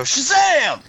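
high, loud superhero battle cry; screamed catchphrase. Pretend. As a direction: belt out the magic word for fun. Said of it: "'cause I can go".

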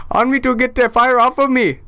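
put-on high-pitched Indian-accented pleading; mock Indian accent. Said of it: "You just stayed consistent".

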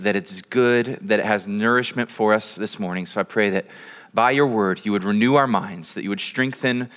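low, measured pastoral prayer. Real, not acted.

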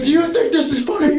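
high-pitched mock scolding yell; imitated yelling. Student retelling the counselor confronting him, imitated high yell. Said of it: "she started yelling at me".

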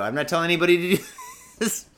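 fast, laughing through the words. Real, not acted. Ranting vlogger laughing at his own advice, laughing. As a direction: break into laughter as you speak.

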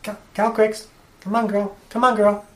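high, coaxing call to a pet. Acted. Player coaxing the dragon closer, high and sweet. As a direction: coax her over like a dog.

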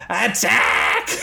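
high, drawn-out rallying shout; battle cry. Acted. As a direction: call the charge. Leader rallies family to attack.

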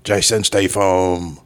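slow British action-star sign-off; slow, stretched British tough-guy voice; Jason Statham. Acted. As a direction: draw out the name in a put-on British accent. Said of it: "Okay, I'm back".

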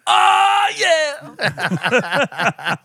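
high, loud whooping yell; shouting out to Jeremy with a wild yell. Real, not acted. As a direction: whoop it like a rock cheer.

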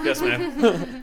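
laughing "Yes, ma'am" like a soldier; mock obedient, laughing. Friend jokes about obeying the wife's orders, laughing mock-obedient. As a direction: salute her with a giggle.

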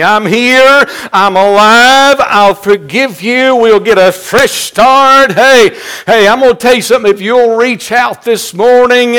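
high, loud, driving pulpit cry; full-force preaching. Acted. Preacher urging church to reach out this morning, full-force preaching. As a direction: belt it out urgently to the congregation.

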